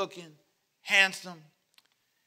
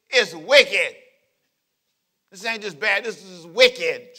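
normal talking voice

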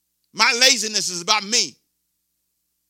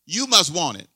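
normal talking voice

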